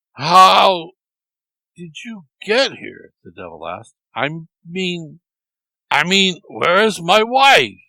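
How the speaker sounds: high, slow, alarmed disbelief; stunned, voice climbing; shocked. A narrator's reading. Devil at breakfast seeing Erky alive, shocked high voice.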